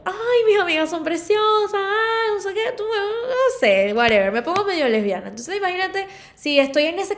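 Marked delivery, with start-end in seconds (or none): 0.0-4.3 put-on high, gushing tipsy girl. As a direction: gush over your friends, tipsy.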